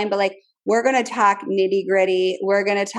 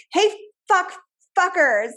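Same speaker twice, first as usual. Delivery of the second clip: mock parent yell; 9 semitones higher than usual. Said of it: "We're also like".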